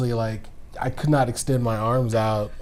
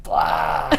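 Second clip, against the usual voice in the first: zombie; 5 semitones higher, 4 dB louder than usual. Acted. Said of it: "your biggest zombie noise".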